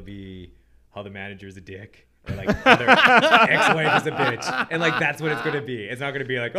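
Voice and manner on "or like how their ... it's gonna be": high, loud laugh through the words. Real, not acted. Friends joking about accents, laughing loudly. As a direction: crack up mid-sentence. Laughing while mocking topics.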